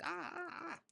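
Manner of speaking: high, soft fluttery ahs; high helpless ahs; scattered dither. Retold or imitated. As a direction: flutter about like you cannot choose. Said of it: "comes out like".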